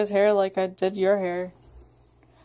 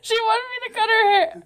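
laughing; 11 semitones higher than usual. Real, not acted. Laughing through story.

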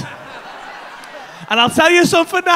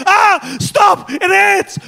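higher, louder cry of stop; hammed-up pleading yell; mock pained shout; 7 semitones higher, 5 dB louder, much slower than usual. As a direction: yell it out in comic agony. Retold about-to-shout plea.

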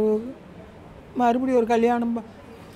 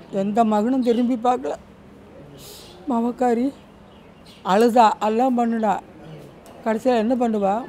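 ordinary talk

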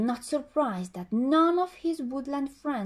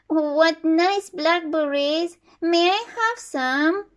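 chirping; 5 semitones higher, 7 dB louder than usual. Acted. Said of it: "chirped Sparrow".

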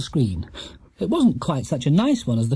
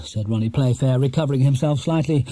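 usual speaking voice

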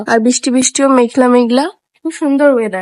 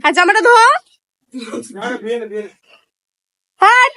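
scolding; 5 semitones higher than usual. Real, not acted. Scolding a splashing pet.

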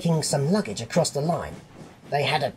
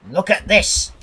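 loud, raised scolding; angry shout; 6 semitones higher, 6 dB louder than usual. A narrator's reading. Fat controller showing Percy the damage, angry shout. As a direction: shout it as a cross order. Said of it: "he shouted".

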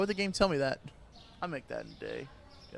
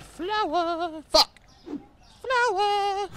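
loud, high, outraged cry over flour; outraged yell; 7 dB louder, much slower than usual. Real, not acted. Store owner yelling at a flour-buying customer, outraged shout. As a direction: howl it in mock outrage.

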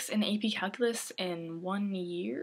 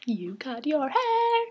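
louder, slow, bright-eyed gasp of surprise; excited exclaim; 5 semitones higher, 8 dB louder, much slower than usual. Retold or imitated. Schoolmates noticing her haircut, surprised and louder. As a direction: play the surprised friend, drawn out. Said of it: "people that I didn't know were like".

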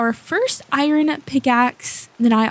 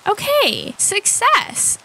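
relieved; 10 semitones higher, much slower than usual. Real, not acted. Relief after killing zombie.

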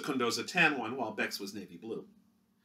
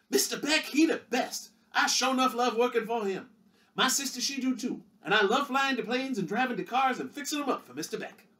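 much higher, earnest, simple country voice; high, chirpy hired-hand voice; eager young mechanic; 13 semitones higher, 5 dB louder than usual. Acted. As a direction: beam with pride for the boss. Mechanic Gregory interviewed.

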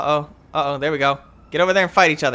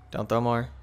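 usual speaking voice

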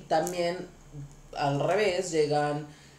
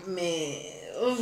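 ordinary talk

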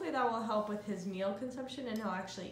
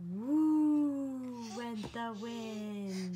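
storybook reading; much slower than usual. Acted. Reading rhyme to baby.

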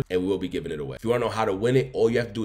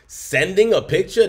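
high, loud, slowed disbelief; incredulous shout; 10 semitones higher, 7 dB louder, slower than usual. Real, not acted. Friend hearing about the picture, shouting in disbelief. Outraged at cheating.

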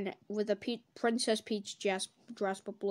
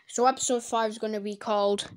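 ordinary delivery

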